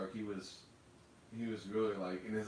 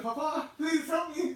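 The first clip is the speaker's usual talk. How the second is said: put-on very high, loud, slow whiny kid voice; mock child pleading; 7 dB louder, slower than usual. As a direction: beg like a hammed-up little child. Parodying a classic father-son farewell.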